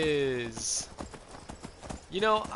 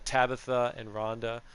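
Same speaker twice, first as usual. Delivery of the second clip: ordinary delivery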